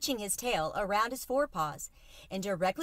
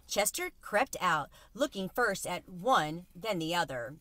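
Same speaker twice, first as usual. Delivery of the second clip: usual speaking voice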